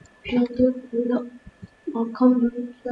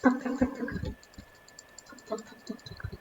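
mock gunfire; 11 dB quieter than usual. Imitating gunshots while playing.

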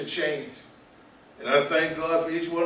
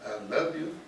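I love you said much softer, "love" gently stressed; soft recap; 8 dB quieter than usual. Shown as a demonstration. Speaker recapping the lesson to students, soft and gentle. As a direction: murmur it back as a tender reminder. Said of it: "They'll take you a long ways".

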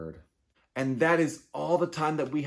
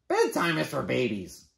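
scornful bird voice; 3 semitones higher, 5 dB louder than usual. Acted. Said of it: "said Bird".

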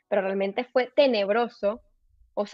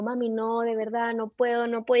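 ordinary delivery

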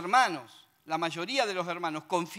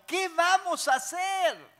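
much higher, slow alarmed plea; panicked question; 11 semitones higher, 5 dB louder, slower than usual. A narrator's reading. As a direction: beg for a way out, frightened. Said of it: "exclamó". (pregunta angustiada, mucho más aguda)

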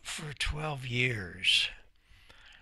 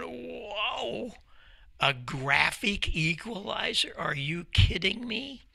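higher, reliving the astonishment; retold amazement; 8 semitones higher, 3 dB quieter, slower than usual. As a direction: gasp it out like you can't believe it. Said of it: "I'm going".

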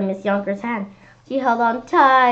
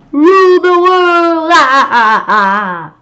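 slow, high, loud villain laugh; evil cackle; 8 semitones higher, 11 dB louder, slower than usual. Acted. Monster dreaming of world rule, evil cackle. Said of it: "an evil cackling laugh".

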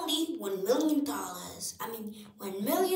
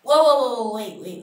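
panicked; 13 dB louder, faster than usual. Acted. Toy panics before kick.

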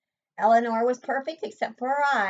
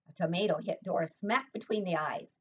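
ordinary delivery, nothing marked

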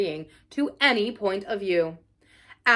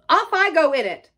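loud, high exultant finish; gleeful defiance; 6 semitones higher, 7 dB louder than usual. Acted. Toad boasting of his next drive, exultant. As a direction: zoom off in triumph.

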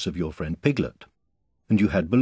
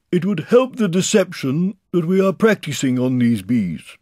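high, loud bear voice; Pooh calling instructions; 12 semitones higher, 9 dB louder than usual. A narrator's reading. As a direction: earnestly call the plan down from above.

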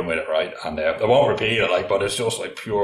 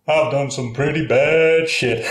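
slow, raised mimicry of the robber; hammed-up slow, higher confession; confessing robber; 4 semitones higher, 5 dB louder, slower than usual. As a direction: drag out the confession for the punchline. Robber's confession acted as punchline.

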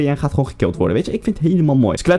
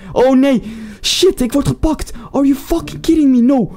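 panicked yell; 11 semitones higher, slower than usual. Real, not acted. Streamer losing the match, panicked high yell. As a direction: yelp as it all falls apart. His tower about to be taken.

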